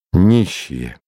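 understated gloomy one-word retort; sullen, curt. A narrator's reading. Valik answers his friend's question, sullen and curt. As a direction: cut the question off glumly. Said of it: "угрюмо отрезал Валик".

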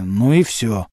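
ordinary delivery

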